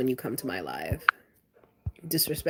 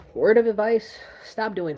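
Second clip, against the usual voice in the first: higher and louder, calling out the viewers; loud warning; 4 semitones higher, 7 dB louder than usual. Real, not acted. Warning rude restaurant workers, loud and raised. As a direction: raise your voice to start the lecture.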